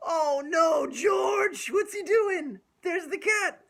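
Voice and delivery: high, loud mock panic; hammed-up shout. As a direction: shout it for the kids' excitement. Story climax.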